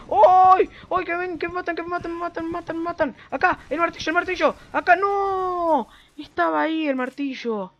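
panicking gamer. Real, not acted. Ambushed, yelling for help.

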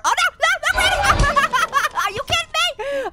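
high, laughing "oh no"; laughing panic. Real, not acted. Caught by Granny, laughing panic.

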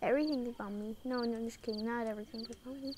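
ordinary talk, nothing marked

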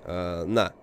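ordinary talk

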